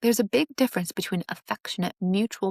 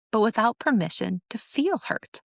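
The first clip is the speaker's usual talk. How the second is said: normal talking voice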